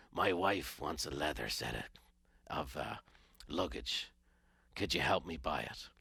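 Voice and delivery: put-on low, soft, heavy voice of a grumpy old teacher; gruff teacher voice. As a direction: growl it low and quiet like a soulless strict teacher. Grumpy teacher asks a favour.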